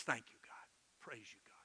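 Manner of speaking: soft thanks fading into a whisper; low, quiet prayer trailing to a whisper; hushed prayer. Shown as a demonstration. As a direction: murmur praise privately to God, dropping away. Models a short private prayer.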